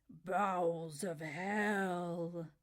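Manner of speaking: very slow, dark monster growl. A narrator's reading. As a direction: drag it out like a hell beast. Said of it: "in his best monster voice".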